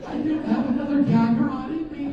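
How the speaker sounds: strained, worn-out rasp; hoarse voice. Shown as a demonstration. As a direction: talk like your throat is shot. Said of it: "I sounded like this".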